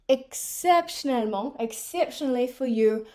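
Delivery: grand concession. Retold or imitated. Store clerk finally agreeing to the refund, mock magnanimous. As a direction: grant a great favour grandly. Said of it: "And then it comes around".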